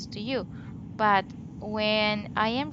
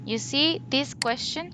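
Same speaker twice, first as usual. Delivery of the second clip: usual speaking voice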